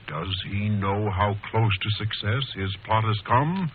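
slow, dramatic announcer voice building suspense; radio serial narration. Acted. Announcer asks listeners about the villain, dramatic narration.

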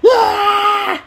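high whiny fake wail; mock crying tantrum. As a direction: throw a hammed-up crying fit. Mock tantrum after teasing.

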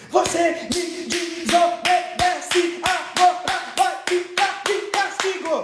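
high, slow, chopped parent's rebuke; syllable-by-syllable scolding. Acted. Parent smacking on each syllable, high choppy scolding. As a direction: break every syllable apart like blows. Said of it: "Quem nunca apanhou por sílaba".

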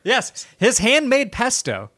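high, slow, put-on salesman voice; drawn-out mock pitch; hammed-up pitchman. Hamming up the joke product.

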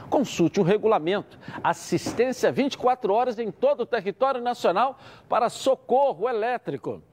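upbeat pitchy sales read; hard sell ad. Acted. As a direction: sell it like a radio spot. Host reads live sponsor ad. (locução de anúncio animada)

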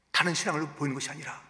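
ordinary talk

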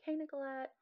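high, soft mock hello; imitated doctor's greeting. Doctor greeting his patient, imitated high greeting. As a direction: pitch the doctor's hello up lightly.

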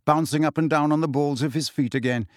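plain reading voice

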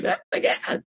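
breathless whispered gasp; airy excited whisper as Charlie; hushed amazed character voice; Charlie's gasp. Acted. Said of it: "put on the glasses".

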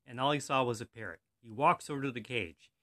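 normal talking voice

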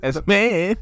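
imitating Australian accent; mock Aussie. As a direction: parrot it in a put-on Aussie voice. Mimics the phrase.

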